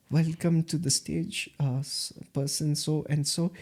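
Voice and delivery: soft, hesitant stage welcome; unenthusiastic. Retold or imitated. Nervous first-timer rehearsing an MC introduction, unenthusiastic and soft. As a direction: mumble a timid introduction with little projection. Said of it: "you have to say it with a lot of enthusiasm".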